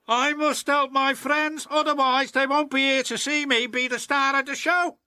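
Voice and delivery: high, fast resolved engine voice; decide to help his friends; determined James. Acted. James resolving to help his friends, high determined voice.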